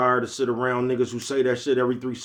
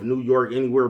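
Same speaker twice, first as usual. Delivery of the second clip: usual speaking voice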